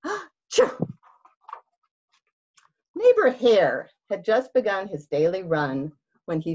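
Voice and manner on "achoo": big comic sneeze. Acted. Reader acting a sneeze for the fact box, high and loud. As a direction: gasp then high loud achoo.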